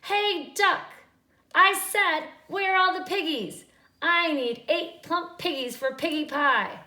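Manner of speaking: high, loud witch demanding; loud, high impatient witch; angry witch. A narrator's reading. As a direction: bark at the duck for answers. Witch yells at the duck.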